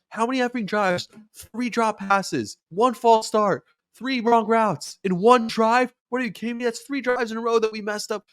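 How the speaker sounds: high, slowed-down furious tirade; angry yelling. Retold or imitated. Quarterback Rodgers berating his offense after sloppy drives, high angry yell. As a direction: spit out the count of mistakes like a fed-up leader. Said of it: "he's yelling at the team".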